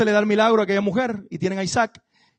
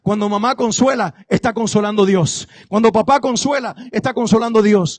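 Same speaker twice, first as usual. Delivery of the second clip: full-force preaching, louder; predicando con fuerza; 4 semitones higher, 8 dB louder than usual. Acted. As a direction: proclaim God's care over the crowd. (predicación vehemente)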